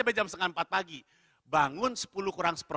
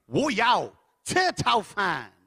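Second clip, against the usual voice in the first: louder, drawn-out dubbed-hero order; action-film Mandarin; 4 dB louder, much slower than usual. Retold or imitated. Diner tries again on silent staff, forceful dubbed-film style. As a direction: push each chunk out like a movie fighter. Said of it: "bermodalkan nonton Fast and Furious".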